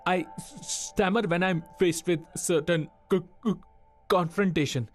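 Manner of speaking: halting, with blocks on first consonants; stammering. Acted. Trainee explaining his problem to the interviewer, stammering. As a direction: stutter on hard sounds, pausing until each word releases.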